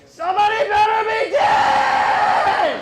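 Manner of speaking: high, loud, slow furious yell; furious shout. Retold or imitated. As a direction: bellow it like a raging driver. LA driver road rage.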